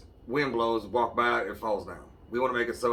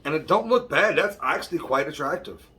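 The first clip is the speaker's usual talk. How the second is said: excited; 5 semitones higher, 6 dB louder than usual. Real, not acted. Delighted with the foil holder.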